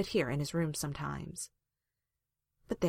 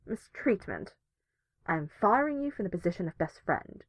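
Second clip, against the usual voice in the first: muffled grumpy protest; 3 semitones higher than usual. A narrator's reading. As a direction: complain from under the blankets. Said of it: "came a muffled voice from the blanket lump".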